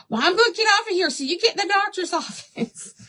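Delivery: high, hurried mom voice; imitating mom. Voicing mom ending the call.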